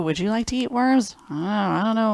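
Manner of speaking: ordinary delivery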